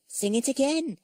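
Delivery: put-on high, loud little-girl plea; excited small character, pitched right up; eager request. A narrator's reading. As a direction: beg brightly for a song. Tessie eagerly asks for the song.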